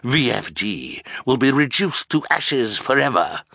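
triumphant villain boast; crowing. A narrator's reading. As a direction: crow over the coming destruction. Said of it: "Olaf crowed".

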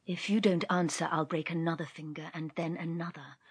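low, hushed, icy threat; quiet menace. Acted. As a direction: murmur the threat coldly. Coulter threatening to break fingers.

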